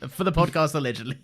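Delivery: allegedly said for the record, loud and pointed; "allegedly" set apart as a legal disclaimer. Shown as a demonstration. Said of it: "I'll say it then".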